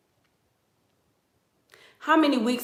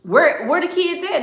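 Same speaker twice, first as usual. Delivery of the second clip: higher, clueless where-are-they; blank missing girl; 9 semitones higher than usual. Retold or imitated. Missing girl clueless about her kids, mock high. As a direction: play dumb, high. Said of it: "And she like".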